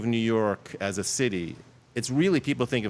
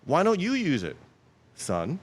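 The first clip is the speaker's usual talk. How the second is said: dad's voice; 8 semitones higher than usual. Retold or imitated. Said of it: "He's like".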